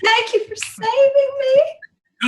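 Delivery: high, slow, teary thanks; tearful gratitude. Acted. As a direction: sob out your thanks to him. Character hugs rescuer.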